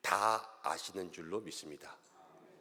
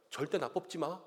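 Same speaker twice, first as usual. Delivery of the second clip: building from a teasing warning to a loud, higher threat; mock threat; 8 semitones higher, 5 dB louder than usual. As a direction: play a candidate who says don't vote for me but means vote for me or else. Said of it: "막 이렇게 얘기하시는데".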